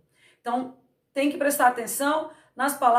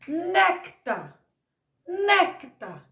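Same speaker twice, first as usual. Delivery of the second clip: Néctar with né pitched up and hit hard; Néctar, leaning on the first syllable né; stress on NÉ; 4 semitones higher, much slower than usual. Shown as a demonstration. Teacher stressing né in Néctar for the students, first syllable raised. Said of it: "Olha a força do né".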